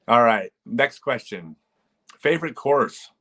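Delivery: ordinary delivery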